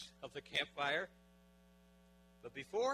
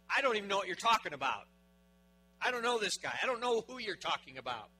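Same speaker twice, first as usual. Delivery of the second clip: loud, higher-pitched protest; emphatic denial; 6 semitones higher, 9 dB louder than usual. Acted. Peter to his accusers disowning the man, emphatic denial. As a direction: deny it forcefully, acting out the disciple.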